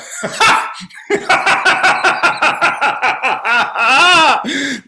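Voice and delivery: loud, high hyena cackle; long staccato cackle rising to a whoop; hyena laugh. Shown as a demonstration. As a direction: let out a huge, wacky hyena-like laugh. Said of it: "which was this hyena-like laugh".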